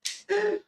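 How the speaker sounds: high, bright burst of a giggle; gleeful giggle. Real, not acted. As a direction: laugh with glee at your own cheekiness. Gleeful about pocketed sweets.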